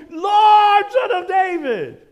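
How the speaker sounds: high, loud cry to be heard; desperate shout. Retold or imitated. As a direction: call out over the crowd.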